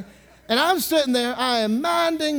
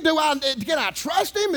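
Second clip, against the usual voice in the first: normal talking voice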